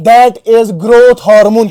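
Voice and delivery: each word drawn out slowly for notes; teacher to class, spelling out the term very slowly; slow dictation. Shown as a demonstration. Teacher dictating to students, words drawn out for notes. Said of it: "क्या बेटा?".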